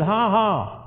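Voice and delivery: deliberate, joyless ha ha; forced laugh. Retold or imitated. Man told by God to laugh again, forced. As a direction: spell out a token laugh. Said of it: "Laugh again".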